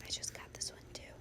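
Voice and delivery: very soft, quick whisper. Real, not acted. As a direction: drop to a hushed, hurried whisper. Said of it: "Why am I whispering".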